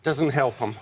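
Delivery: breathless after climbing stairs; out of breath, panting lightly. Real, not acted. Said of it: "You seem a little out of breath".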